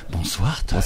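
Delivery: hammed-up late-night purr; imitating a sensual voice. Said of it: "on se croit au trente-six quinze".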